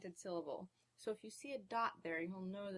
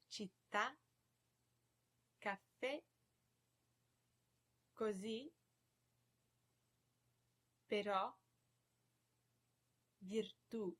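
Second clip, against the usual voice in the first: città, caffè, così, però, virtù, each landing on the last vowel; final-vowel stress. Shown as a demonstration. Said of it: "is stressed on that vowel".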